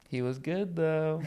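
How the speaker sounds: grudging admission. Pretend. Comedian imagining a critic who must still concede, quietly grudging. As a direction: drop the volume right down, as if conceding.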